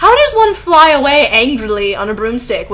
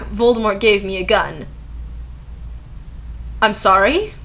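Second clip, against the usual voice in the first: ordinary delivery, nothing marked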